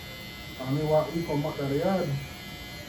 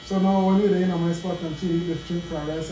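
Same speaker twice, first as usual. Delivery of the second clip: usual speaking voice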